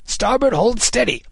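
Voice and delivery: high-pitched shouted ship's command; Kidd calling orders. A narrator's reading. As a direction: call out an order to the oarsmen. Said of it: "he called, and".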